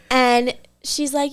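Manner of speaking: normal talking voice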